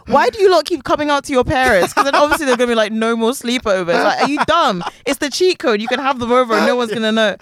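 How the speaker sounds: high-pitched incredulous ranting; comic exasperation, pitched well up; exasperated. Retold or imitated. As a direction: scold them like they're being silly. Mock-scolding characters for coming out.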